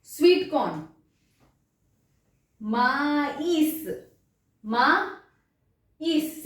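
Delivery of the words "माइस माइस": माइस very slowly, split as ma-EES; both vowels sounded. Shown as a demonstration. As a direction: stretch माइस into two separate vowels, ending on S. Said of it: "दोनों pronounce होंगे".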